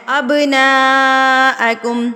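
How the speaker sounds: slow chanted tajweed recitation; Quran recitation. Acted. Teacher recites the verse in slow tajweed chant. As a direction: recite it slow and melodic, drawing out vowels.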